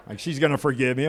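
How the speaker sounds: ordinary delivery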